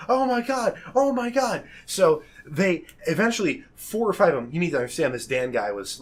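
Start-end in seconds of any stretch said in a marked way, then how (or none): 0.1-1.6 high, urgent repeated exclamation; mock panic. Dan fearing getting kicked out of the Marines, panicked. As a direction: play it as mildly frantic, pitched up.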